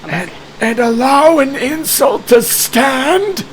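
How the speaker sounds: indignant high mummy voice, slow; dusty mummy rasp. Acted. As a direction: huff it like offended undead.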